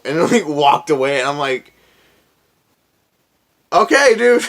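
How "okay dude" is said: much higher, louder disbelief; baffled send-off; squeaky stunned okay dude; incredulous okay. Retold or imitated. Officer walks away, incredulous.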